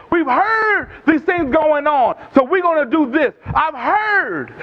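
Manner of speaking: high-pitched, insistent sermon delivery; impassioned preaching. Real, not acted. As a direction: drive each point home to the congregation. Preaches with rising intensity.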